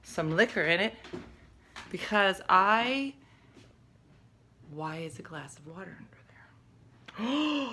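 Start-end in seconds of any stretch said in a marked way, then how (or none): 4.7-7.7 shocked hushed whisper of disbelief. Real, not acted. Mom finding glasses under her kid's bed, stunned whisper.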